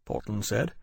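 ordinary delivery

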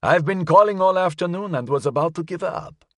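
put-on high-pitched old gentleman; curious elderly man. A narrator's reading. Elderly caller finally reaching the detective, curious old voice. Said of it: "sounded as if it belonged to an elderly man".

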